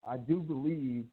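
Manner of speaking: muffled and faint, mic covered. Real, not acted. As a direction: voice smothered and far off. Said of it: "you sound muffled".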